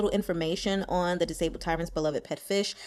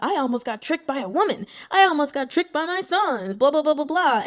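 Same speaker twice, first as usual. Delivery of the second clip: higher-pitched mock complaint; mock angry king; 6 semitones higher than usual. King blaming other people for tricking him, mock angry rant. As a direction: imitate a king ranting, dismissively. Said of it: "putting his anger on other people".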